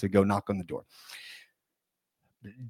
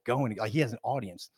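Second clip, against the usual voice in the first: usual speaking voice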